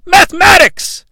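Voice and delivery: high, loud triumphant cry; triumphant shout. Acted. Comic hails mathematics, triumphant shout. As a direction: proclaim it like a grand discovery.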